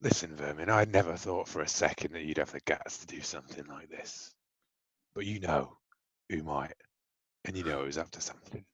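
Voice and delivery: quiet, restrained threat; menacing boss. Acted. Boss leans on Vermin for a name, quietly menacing. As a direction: press the underling softly, with pauses.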